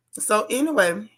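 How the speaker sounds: ordinary talk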